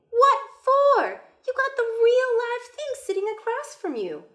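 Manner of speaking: a lively grandma cheering him on; high excited grandmother; Nana. Acted. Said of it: "Nana set down her knitting".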